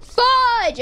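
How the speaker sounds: sudden loud high yell; frustrated shout. Real, not acted. Runs out of cobblestone.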